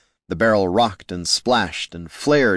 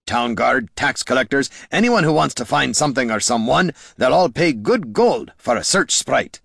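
raised, lively character voice; lightly voiced Gorm explaining; 5 semitones higher, 3 dB louder than usual. A narrator's reading. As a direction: explain the spell with a hint of exasperated character.

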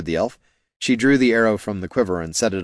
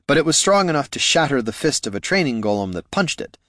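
plain reading voice

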